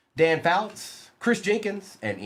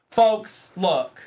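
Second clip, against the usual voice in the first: ordinary talk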